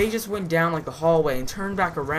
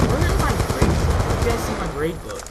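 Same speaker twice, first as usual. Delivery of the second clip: alarmed teacher; 5 semitones higher than usual. Retold or imitated. Teacher asks the class about her grade book, lightly acted alarm. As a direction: imitate a teacher suddenly missing something.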